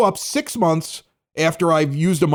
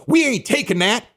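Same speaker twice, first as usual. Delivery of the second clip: raised, exaggerated South Side accent; weird South Side accent; 4 semitones higher than usual. Mocking the announcer playing to Southsiders, fake South Side accent. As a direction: put on a fake South Side Chicago bluster. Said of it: "go into that weird accent".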